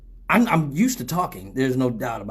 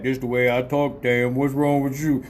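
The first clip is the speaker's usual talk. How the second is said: Mister Motherfucker; 2 semitones lower than usual. Retold or imitated. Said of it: "after you hear motherfucker voice".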